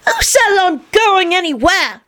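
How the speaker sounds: put-on scowling boy. A narrator's reading. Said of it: "Teddy scowled".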